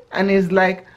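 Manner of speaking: ordinary delivery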